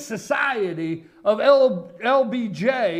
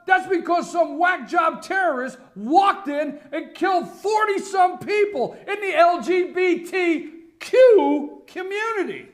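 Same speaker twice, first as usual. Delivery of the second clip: higher, louder, shouting at her; indignant yelling; angry shout; 8 semitones higher, 4 dB louder than usual. Real, not acted. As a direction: rant at Michelle like she's in the room. Host yells at Michelle Obama.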